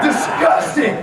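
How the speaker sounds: loud, high, clipped Disgusting after a groan; shouted disgust after groan. Shown as a demonstration. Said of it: "I make a big groan before".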